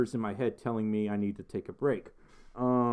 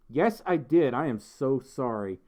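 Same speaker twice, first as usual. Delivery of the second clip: ordinary talk, nothing marked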